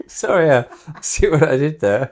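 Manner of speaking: laughing at a slip. Real, not acted.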